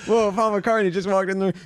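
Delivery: high, laughing mock astonishment; feigned amazement. Co-host fooling listeners about a guest arriving, feigned amazement. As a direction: exclaim at a pretend celebrity arrival. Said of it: "we can trick the Spotify people".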